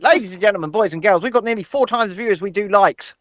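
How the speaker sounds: usual speaking voice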